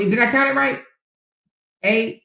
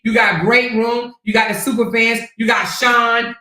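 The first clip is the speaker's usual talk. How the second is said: normal talking voice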